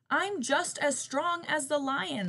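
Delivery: loud, high, slow snarl; snarling tiger. Acted. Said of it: "snarled the tiger".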